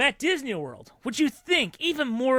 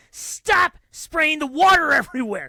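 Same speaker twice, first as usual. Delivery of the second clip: high, loud scolding, faster at end; mom yelling; 10 semitones higher, 6 dB louder than usual. Retold or imitated. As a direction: scold the kid like a frazzled mom. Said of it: "she is yelling at her kid".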